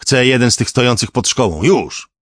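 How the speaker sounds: loud, high, urgent order; shouted demand. A narrator's reading. Composer demanding a students' car at once, shouted demand. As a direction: demand it forcefully, punching the last word.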